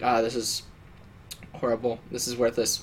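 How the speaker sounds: low, soft retold grumble to oneself; imitating a deflated aside; muttered resignation. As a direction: trail off quietly, giving up. Acts out giving up on waiting.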